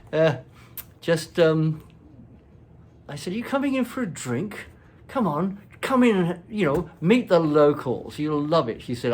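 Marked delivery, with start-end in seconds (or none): 3.3-8.8 eager invitation. Retold or imitated. Retold eager invitation to the prince.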